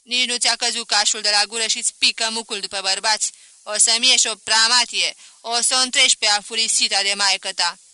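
a stern father berating his daughter; shouted angry rebuke; snapping father. A narrator's reading. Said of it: "Atunci se răstea la mine".

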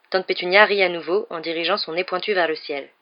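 usual speaking voice

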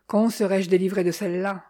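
usual speaking voice

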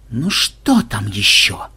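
slow, low grumble; displeased. A narrator's reading. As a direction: drag it out, fed up. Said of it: "недовольно поморщился программист".

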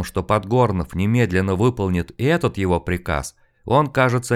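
ordinary delivery

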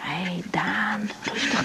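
slow, whispered calming; whispered reassurance to a shaken friend; soothing whisper. Acted. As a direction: ease him down gently in a hush.

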